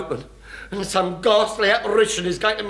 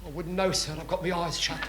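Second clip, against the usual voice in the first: hushed reply; 3 semitones lower, 11 dB quieter than usual. Acted. Chief answers from hiding.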